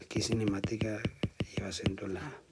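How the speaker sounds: soft unvoiced whisper to the class; whispered aside. Real, not acted. Teacher whispers a spelling note to the class. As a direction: drop to a whisper for a side note.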